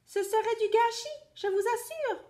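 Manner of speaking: high little bunny voice; squeaky rabbit. Acted. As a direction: coax like a clever rabbit. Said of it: "répond le lapin".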